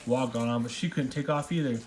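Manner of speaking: usual speaking voice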